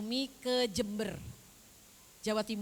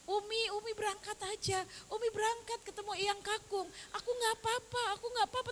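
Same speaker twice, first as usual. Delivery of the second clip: tearful child imitation, pitched way up; imitating tearful grandchild; 10 semitones higher than usual. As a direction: retell a child bravely saying it through tears. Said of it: "Cucu saya sambil nangis".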